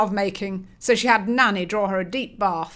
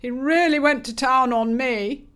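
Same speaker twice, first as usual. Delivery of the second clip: 1 glum; 4 semitones higher, 4 dB louder than usual. Acted. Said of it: "remarked Dre glumly".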